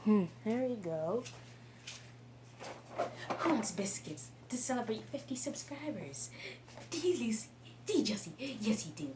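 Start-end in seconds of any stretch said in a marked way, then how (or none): 3.4-9.1 talking to a dog. Real, not acted. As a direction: coo at the dogs softly. Treats for the dogs.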